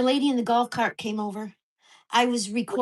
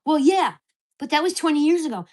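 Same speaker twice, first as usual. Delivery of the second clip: mock neighbor retort; 4 semitones higher, 7 dB louder than usual. Neighbor's reply in retold quarrel.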